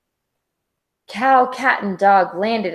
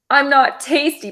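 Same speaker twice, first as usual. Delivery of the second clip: pleading; 6 semitones higher than usual. A narrator's reading. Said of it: "pleaded Dog".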